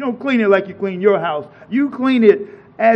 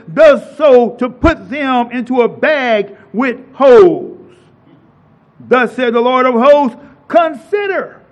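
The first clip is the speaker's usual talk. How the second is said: full-force preaching; 4 semitones higher, 6 dB louder than usual. Acted. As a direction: thunder the prophet's words at them.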